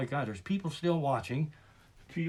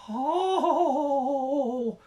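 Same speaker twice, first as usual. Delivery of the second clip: exaggerated drawn-out oh; 8 semitones higher, 4 dB louder than usual. Exclamation before new rant.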